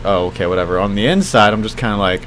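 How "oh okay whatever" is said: low, soft, unbothered act; retold outward nonchalance. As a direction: shrug it off as if unconcerned. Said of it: "on the outside, I'm just like".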